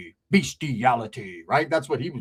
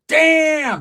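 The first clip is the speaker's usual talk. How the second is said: loud exclamation; 13 semitones higher, 8 dB louder than usual. Retold or imitated. Admiring exclamation.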